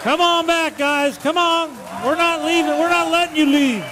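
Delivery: calling out high to the crowd; calling from far away. Real, not acted. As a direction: call out over the cheering. Host calls band back onstage.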